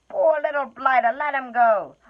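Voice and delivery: a tipsy troll feeling sorry for him; pitying troll. Acted. Troll pleads for hobbit.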